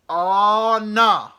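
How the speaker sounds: loud high yell of oh no; big driver shouting in dismay; screaming bus driver. Retold or imitated. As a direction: bellow it at the whole bus. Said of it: "he's, like, screaming at it".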